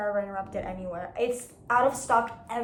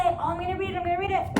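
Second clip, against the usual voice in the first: higher, breezy promise she won't keep; mock eager promise; 7 semitones higher, much faster than usual. Mocking her own empty promise, high and breezy. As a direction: toss off an empty promise to read. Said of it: "because I always say".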